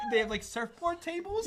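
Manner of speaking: ordinary delivery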